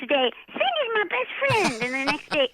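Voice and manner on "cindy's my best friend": high, slow little girl voice imitation; young daughter voice. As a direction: play the fickle little daughter, pitched up. Mother voices her young daughter.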